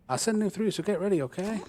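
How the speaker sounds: loud, high call across the room. Acted. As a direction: call out to her from a distance. Manager calls out to Chan.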